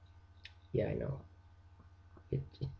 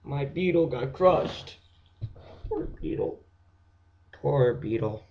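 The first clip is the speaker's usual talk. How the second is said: mock wail; 4 semitones higher, 4 dB louder, slower than usual. Mock grief over crushed beetle.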